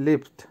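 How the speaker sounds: লিফট as a careful repeat after a short break; teacher's model of Russian লিফট; Russian pronunciation of লিফট. Shown as a demonstration. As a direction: say লিফট distinctly for learners. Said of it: "উচ্চারণ একটু লক্ষ্য করেন".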